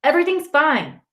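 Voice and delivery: high, defensive yell easing in volume. A narrator's reading. Boy telling his brother to lay off, yelling. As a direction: push back hard in short bursts. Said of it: "I yell".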